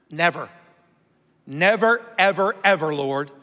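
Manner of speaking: insistent, rising NEVER punched; emphatic never. Retold or imitated. Peter protesting to Jesus, emphatic NEVER. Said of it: "that's an emphatic".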